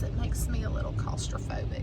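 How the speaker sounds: whispering. Real, not acted. Nervous in the car wash.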